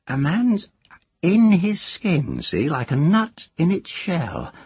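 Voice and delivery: put-on high, teasing old witch; a cackling village witch. A narrator's reading. Moss voiced.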